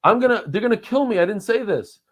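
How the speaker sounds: high, loud sudden alarm; mock panic. Real, not acted. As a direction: yelp as if caught out. Realizes he said too much.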